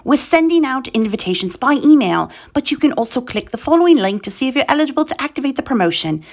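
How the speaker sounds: put-on royal British accent; the Queen. Host reading eBay announcement to UK sellers, mock British Queen accent. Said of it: "I want us to sound like the Queen".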